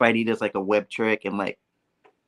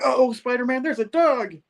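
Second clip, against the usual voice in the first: high-pitched cry of warning; mock alarm; 14 semitones higher than usual. Retelling Betty warning Spider-Man about a dog, mock alarmed. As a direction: call out a hammed-up high warning.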